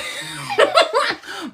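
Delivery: high-pitched laugh at oneself; helpless high giggle. Real, not acted. Host laughing at own tongue slip, high giggle.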